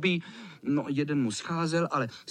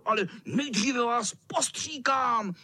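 high, menacing cat voice; hammed-up wicked tomcat; wheezing tomcat threat; 7 semitones higher than usual. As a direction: squeal the threat with relish. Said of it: "sýpal kocour".

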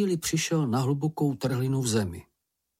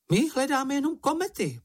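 very high, squeaky voice; high-pitched little character voice; Čenich, small creature. A narrator's reading. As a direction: pipe it up in a tiny falsetto.

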